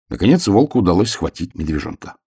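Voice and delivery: plain reading voice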